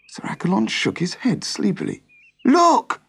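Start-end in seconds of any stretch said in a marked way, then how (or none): 2.4-3.0 sharp, much higher, louder call; urgent cry. Acted. Servant urges Sir Accolon to take the sword, high urgent cry. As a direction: grab his attention, urgent. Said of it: "cried the servant urgently".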